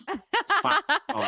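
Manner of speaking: high laughing. Real, not acted. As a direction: laugh through it. Guest laughs while saying it.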